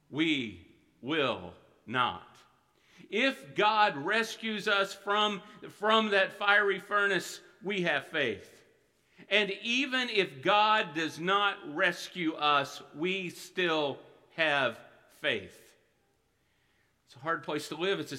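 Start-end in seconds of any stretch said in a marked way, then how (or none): 0.1-2.3 low, slow "We will not"; slow solemn vow. Real, not acted. Preacher repeats vow softly. 3.1-15.6 slow, loud, emphatic preaching; preaching at full force. Real, not acted. Preacher applies the vow.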